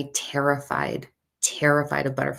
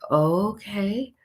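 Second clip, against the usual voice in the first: drawn-out okay rising high; doubtful; 5 semitones higher than usual. Retold or imitated. Woman told by the boy to keep going, doubtful. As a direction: stretch it upward, unsure.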